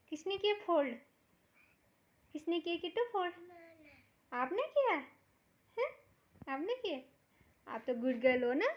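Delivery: high, loud sing-song praise for a little one; baby talk. Acted. Mother praising little Kittu for folding clothes, high baby talk. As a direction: coo over her like a proud mother.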